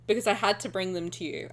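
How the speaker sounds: ordinary talk, nothing marked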